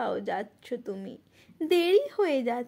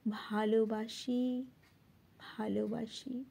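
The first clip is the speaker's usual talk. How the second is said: soft, slow, lowered confession of love; tender reply; 4 semitones lower, 8 dB quieter than usual. Acted. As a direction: answer tenderly, sinking softer on the repeat. Tender answer in the poem's refrain. (কোমল, নিচু স্বরে)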